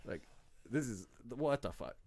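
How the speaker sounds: normal talking voice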